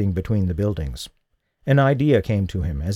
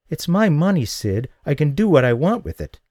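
defiant; 6 semitones higher than usual. Acted. Defiant woman.

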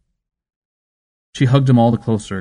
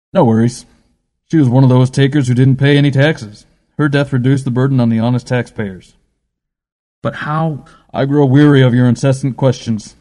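louder, coldly dismissive; callous dismissal; 4 semitones higher, 6 dB louder than usual. A narrator's reading. Adams dismisses the dead mother, louder cold contempt.

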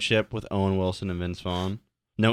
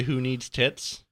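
slow reading; 3 semitones higher, much slower than usual. Real, not acted. Reading shirt slowly.

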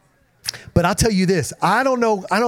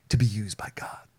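breathy excited whisper, much softer; 7 semitones lower, 8 dB quieter than usual. Real, not acted. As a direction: lean in and whisper it like a secret joy. Preacher drops to excited whisper.

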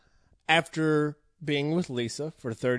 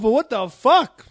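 loud, higher, drawn-out protest; exaggerated indignation; 5 semitones higher, 6 dB louder than usual. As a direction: stretch it out in mock outrage. Acting out indignation over dogs.

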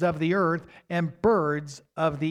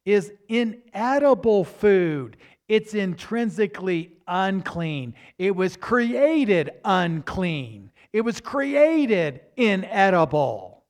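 slow, louder stress on created; emphatic preaching; 3 semitones higher, 6 dB louder, slower than usual. Acted. Preacher stressing that unclean food was created inedible, slow and loud. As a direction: hammer it home slowly.

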